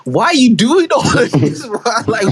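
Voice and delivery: high, imploring complaint; exasperated protest. Retold or imitated. Son retelling argument with mum over going out, exasperated. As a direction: plead in exasperation, acted out.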